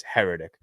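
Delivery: Heretic stressed on the first syllable; correct pronunciation. Shown as a demonstration. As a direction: say Heretic as HER-uh-tik, falling at the end.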